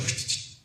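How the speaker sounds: soft unvoiced blade whoosh; a quiet hissed slice; sword swish. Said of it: "he goes".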